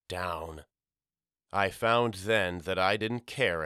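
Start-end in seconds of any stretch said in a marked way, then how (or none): 0.1-0.6 much softer, low down, almost gone; last faded down. A narrator's reading. Narrator sinking into despair, near silent down. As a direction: let the last down die away low and soft.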